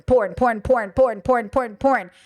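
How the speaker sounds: monotonous repeated drone, each word falling alike; same word over and over, mechanically; mock complaining. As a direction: rattle it off like an endless tired complaint. Mimics everyone's endless complaining about porn.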